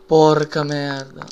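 explosive italian cursing. Real, not acted. Startled curse during jump scare.

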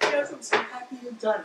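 high pretend appliance voice; Papi Food Processor. Food processor happily answers the cook, high pretend voice. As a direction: answer as a pleased little gadget. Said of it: "He's like".

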